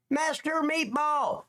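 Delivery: drawn-out greeting. Real, not acted. Streamer greeting a chatter, drawn-out and high. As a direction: sing out "Master Meatball" slow and high.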